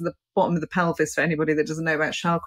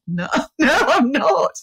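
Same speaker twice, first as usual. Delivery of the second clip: laughing; 8 semitones higher, 5 dB louder, slower than usual. Retold or imitated. Embarrassed retold denial.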